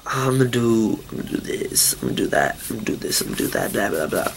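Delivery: low, soft run-through, barely voiced; dismissive mumble. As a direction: mumble the list away under your breath. Rattles off own careless past thoughts.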